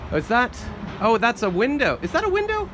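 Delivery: rising, excited surprise at a window; sudden real wonder, pitch jumping up; excited double take; surprised discovery. Real, not acted.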